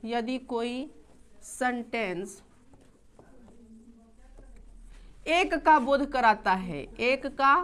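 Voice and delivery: slow, soft dictation pace. Shown as a demonstration. Teacher dictates the rule to students, slow and soft. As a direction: read it out slowly so they can write. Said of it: "लिखो".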